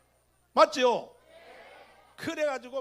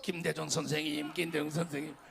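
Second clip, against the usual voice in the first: low mock-reverent repetition; mock devotion; 7 semitones lower than usual. Preacher imitating loyal Kim Dae-jung supporters, mock devotion. Mocking devoted followers' endless chant.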